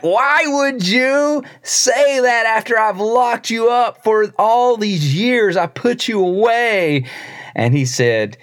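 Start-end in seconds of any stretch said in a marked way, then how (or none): 0.0-7.0 high, slow bewildered king voice; high-pitched baffled king; incredulous king. Retold or imitated. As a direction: ask in disbelief as the king. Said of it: "the king was like".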